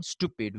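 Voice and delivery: stupid as "stoo-pid"; plain oo in stupid, no y; no yes sound. Shown as a demonstration. Teacher repeating the American form, "stoo-pid" for stupid. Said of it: "without the incorporation of the yes sound".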